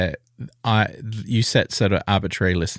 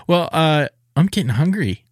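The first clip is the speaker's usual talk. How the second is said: pointed hint; 8 semitones higher, 4 dB louder than usual. Acted. Wrapping up the podcast.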